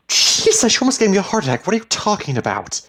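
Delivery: very high, loud and shocked; shrieked reproach after a fright; startled outburst. A narrator's reading. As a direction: jump out of your skin, then demand answers. Wife startled by his sudden shout.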